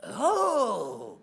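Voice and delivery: high, loud shout of discovery; sudden realization. Retold or imitated. Preacher reliving the moment he understood his wife, shouting high. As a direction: burst out as it finally clicks. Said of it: "and I go".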